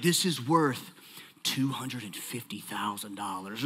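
low, quiet, matter-of-fact expert reveal; appraiser announcing the price. Retold or imitated. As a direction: deliver the valuation hushed and low. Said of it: "they're like".

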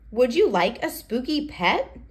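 plain reading voice

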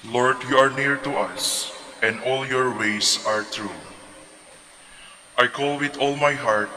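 normal talking voice

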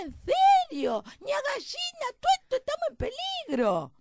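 very high, frantic loud squeal; insistent mouse. Acted. Mouse insisting to the hen of danger, frantic shouting. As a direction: plead at the top of a tiny voice.

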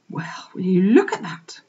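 whispered in awe. A narrator's reading. Farmer's wife whispering over the sleeping piglet. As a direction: breathe it so the piglet stays asleep.

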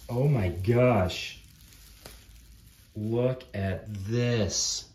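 loud, slow, stretched-out amazement; amazed exclamation. Real, not acted. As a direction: exclaim it loudly, dragging the words. Big reaction to next miniature.

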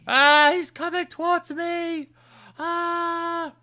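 very high, loud, slow terrified scream; panicked shriek. Real, not acted. Gamer fleeing a mob charging at him, shrieking. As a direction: shriek as it closes in.

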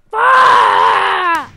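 loud, drawn-out curse; frustrated yell. Real, not acted. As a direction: let out a long shout of rage. Swearing after rage at teammates.

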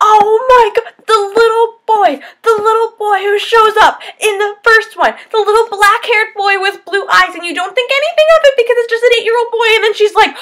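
high, loud and breathless fangirling; excited gushing. Real, not acted. Reviewer gushing to viewers about the little boy twist, high and loud. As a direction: spill it all out in a rush of excitement.

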